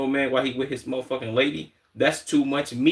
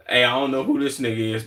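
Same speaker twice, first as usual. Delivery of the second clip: ordinary delivery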